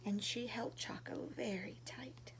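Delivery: whisper. A narrator's reading. Tender storybook moment.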